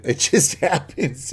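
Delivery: slow, laughing through the words. Real, not acted. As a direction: chuckle the line out as a guilty joke. Joking about chopping up bodies.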